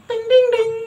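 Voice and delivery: high sing-song ding; high chime imitation.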